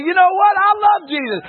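loud, high proclamation of faith; bold declaration. Pretend. Preacher urging the church to speak out boldly, loud and proud. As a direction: shout it out proudly.

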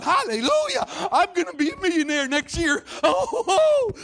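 hammed-up shriek of joy; very high, loud whooping with laughter; mock excitement. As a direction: whoop like an overexcited congregant. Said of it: "you're getting all excited".

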